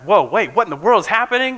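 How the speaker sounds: high, quick startled cry; startled disciples. Retold or imitated. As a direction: disciples shocked by Jesus appearing, high startled voice.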